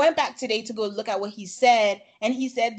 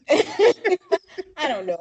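laughing; 5 semitones higher, 4 dB louder, much slower than usual. Real, not acted. Laughs, gives up on the topic.

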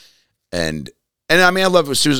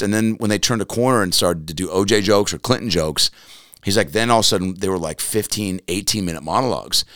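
ordinary talk

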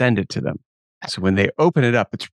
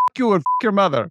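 higher, a hammed-up angry snap; mock insult; 9 semitones higher, faster than usual. Scammer swearing at the host, mock angry. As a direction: imitate the scammer cursing back. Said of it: "the rabbit turns around and goes".

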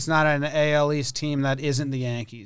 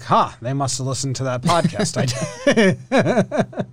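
laughing; 3 dB louder than usual. Pretend. Imagined reaction, laughing through it.

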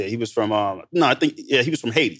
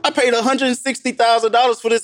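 higher, smug voice showing off to himself; inner boast voice; 9 semitones higher than usual. Retold or imitated. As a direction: brag inwardly in a raised put-on voice. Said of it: "I'm thinking to myself".

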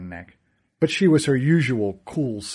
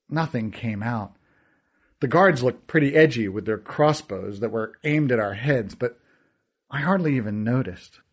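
usual speaking voice

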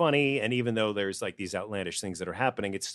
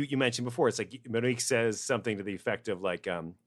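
normal talking voice

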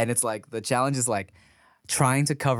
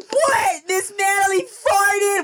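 very high, drawn-out squeal of shock; mock screaming disbelief; 4 dB louder, much slower than usual. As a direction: shriek it like a scandalized class.

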